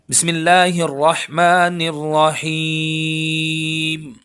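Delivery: drawn-out melodic tajweed chant, slow; slow Quran recitation. Shown as a demonstration. Teacher begins the lesson by reciting, slow melodic chant. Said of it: "आइए सबक को शुरू करते हैं।".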